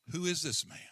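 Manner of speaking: normal talking voice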